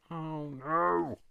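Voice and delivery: drawn-out loud dismay; a slow horrified oh no. Real, not acted. Crafter spots her mistake, loud drawn-out dismay.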